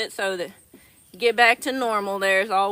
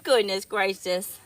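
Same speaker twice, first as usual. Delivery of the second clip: usual speaking voice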